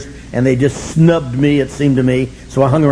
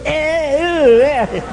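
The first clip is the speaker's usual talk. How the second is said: mocking Al-Anon members; 10 semitones higher, much slower than usual. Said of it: "They go".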